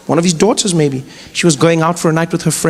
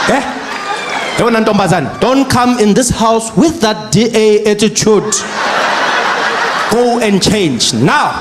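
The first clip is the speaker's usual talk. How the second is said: stern father. Retold or imitated. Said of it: "father final order".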